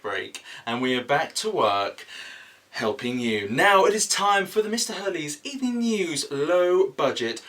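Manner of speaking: hammed-up TV weather read; mock weather presenter. Weatherman [name] presenting the evening weather to viewers, hammed up.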